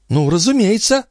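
high feminine voice, rising; countess's assent. A narrator's reading. Countess confirming Balsamo's story, high and assenting. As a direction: agree readily in a high lady's tone. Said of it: "ответила госпожа Дюбари".

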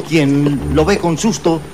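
ordinary delivery

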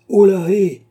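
high, loud shout to wake someone; shouted call. A narrator's reading. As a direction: holler sharply into a sleeper's ear. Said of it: "cria le jeune homme à son oreille".

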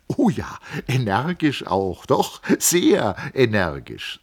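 put-on young man explaining his family; lightly voiced Hans Castorp. A narrator's reading. Castorp telling Settembrini about his uncles, lightly voiced.

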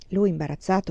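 ordinary delivery

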